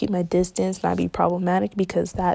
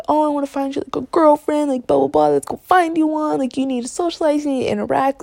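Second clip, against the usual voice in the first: mocking pushy friends; 6 semitones higher than usual. Friends pushing her to date.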